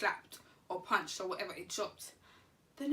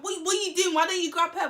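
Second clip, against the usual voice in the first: louder, higher outraged protest; angry protest; 7 semitones higher, 7 dB louder than usual. Retold or imitated. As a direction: demand it, fuming. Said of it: "And I'm just like".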